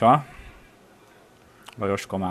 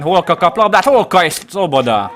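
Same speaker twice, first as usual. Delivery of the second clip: much higher, louder urgent call; excited commentary; 8 semitones higher, 8 dB louder than usual. Real, not acted. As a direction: shout the attack as it unfolds. Visitors' chance. (izgatott támadáskiáltás)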